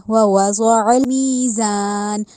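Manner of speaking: ووضع المیزان with ain from the throat, slow chant. Shown as a demonstration. Teacher recites ووضع المیزان slowly as model. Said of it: "اس کو حلق سے نکالنا ہے".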